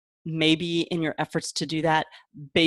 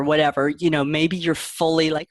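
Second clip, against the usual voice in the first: ordinary talk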